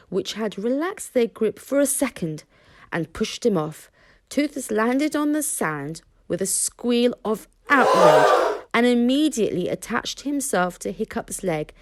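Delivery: ordinary delivery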